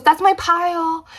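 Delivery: high, slow piping claim; squeaky little "that's my pile". Retold or imitated. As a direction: pipe it up high and a bit slow, possessive. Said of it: "she pipes up and she goes".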